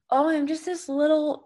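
high, mock timid little voice; a hammed-up tiny self-pitying voice; mock small self. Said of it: "Don't play the game of".

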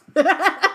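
high cackling evil laugh. Real, not acted. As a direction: cackle wickedly. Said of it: "most evil laugh".

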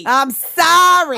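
loud, high, stretched-out sorry; mock apology shout. Co-host answering with apology to Boston, in a drawn-out mock shout. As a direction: drag the apology out in a yell.

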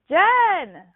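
high loud holler of a name; dad hollering Jen; calling out. Retold or imitated. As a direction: yell her name from the river. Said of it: "he was like".

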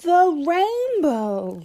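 high, loud, slow laughing cry; a young fox laughing out the answer in delight. A narrator's reading. Little fox to mommy, laughing out the answer. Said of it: "laughed Little Fox".

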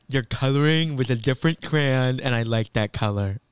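put-on voice mimicking a reality star; Dorinda impression. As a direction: do a spot-on celebrity imitation. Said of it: "you sound just like her".

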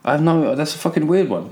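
ordinary talk, nothing marked

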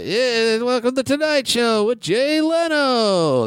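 mocking Stuttering John's Tonight Show announcer job. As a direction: announce the show like a cheesy late-night host. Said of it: "He was the announcer".

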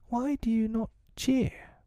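put-on very high, indignant boy; hotly. A narrator's reading. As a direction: demand it in a high-pitched huff. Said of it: "he said hotly".

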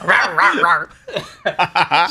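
playful zombie rawr, laughing; mock monster growl through a laugh. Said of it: "I'll go zombie on you later".

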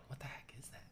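hushed, whispered disbelief; secretive, barely voiced question; alarmed whisper. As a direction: whisper it like campers hearing a noise. Campers react to night noise.

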